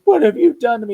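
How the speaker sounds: high, loud put-on monster voice; Evil Ed voice. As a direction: play the wounded vampire crying out. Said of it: "He goes".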